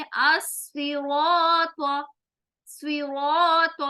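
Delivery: अस्सिरात very slow, with a dark heavy r and aa; heavy Arabic as-siraat. Shown as a demonstration. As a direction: stretch अस्सिरात with full tafkheem. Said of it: "अब यहाँ पर देखें".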